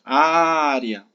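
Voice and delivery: área with a long, loud first Á; área, leaning hard on the first syllable; dragged stressed syllable. Shown as a demonstration. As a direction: call out "área", stretching the opening "á". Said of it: "sílabazinha que você arrasta mais".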